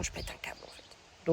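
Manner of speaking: whispered aside. Real, not acted. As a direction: drop to a whisper. Aside while seated.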